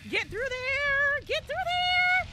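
drawn-out, high-pitched plea; high strained urging. Real, not acted. Urging own character through a gap.

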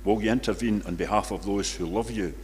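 ordinary talk

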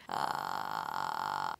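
low creaky door groan; vocal fry creak. Shown as a demonstration. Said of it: "I want you to make this sound".

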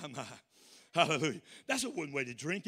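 ordinary talk

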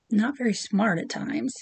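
ordinary talk

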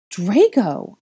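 loud high desperate call. A narrator's reading. Searching the empty room for him, loud call. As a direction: shout his name into the dark. Calling for missing boy.

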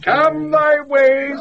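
high mock call.

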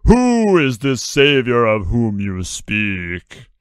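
slow, loud, booming god voice; deep thunder voice. A narrator's reading. Voice in the storm questioning Judas, slow loud boom. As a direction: boom it out slowly from the storm clouds. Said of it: "and the voice continued".

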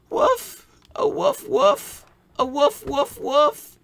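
very high squeaky woofs; imitated barking. Assistant barks for the doctor, very high. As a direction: yap like a small dog.

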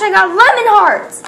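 thrilled announcement; excited. Real, not acted. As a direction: announce the pull with delight. Excited about pull.

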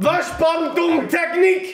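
hammed-up German yell, slow and high; mock German shouting. Mock German shouting at a woman heading for the loo. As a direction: bellow it like a panicking German man.

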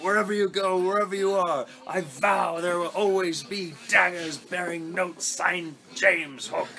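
Hook impression. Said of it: "he's just like".

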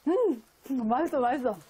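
chewing while talking; muffled by food; mouth full of noodles. Real, not acted. Seen 0: Noodles go in at -2.0; lips work around food while speaking.

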